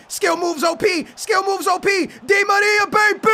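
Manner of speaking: rising match excitement; hyped attack call. Real, not acted. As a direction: yell it as the chance builds.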